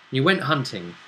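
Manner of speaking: ordinary talk, nothing marked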